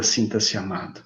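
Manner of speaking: hushed words of love; whispered in the ear. Shown as a demonstration. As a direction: let it fall to a hush. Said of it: "você sussurra pra pessoa".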